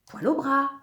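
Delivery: high, cheeky heckler's voice; high little voice, far from a murmur; murmured heckle from inside. A narrator's reading. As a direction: pipe up teasingly from inside a belly. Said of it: "murmure alors Zekeye de l'intérieur du ventre".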